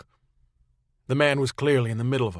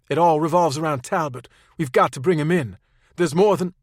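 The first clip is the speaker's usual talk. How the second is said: raised, higher, insistent voice; urgent phone argument; 5 semitones higher, 6 dB louder than usual. Acted. Claire pleads with the captain over the phone, raised and insistent. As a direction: push hard to be heard on the line.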